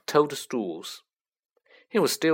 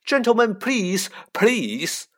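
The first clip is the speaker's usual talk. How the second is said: high, loud pleading cry; 9 semitones higher, 5 dB louder, slower than usual. A narrator's reading. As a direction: beg them to stop, crying out. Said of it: "cried the assistant".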